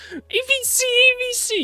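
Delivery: very high, shrill nasal whine; annoying commentary voice. Retold or imitated. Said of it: "the annoying commentary voice".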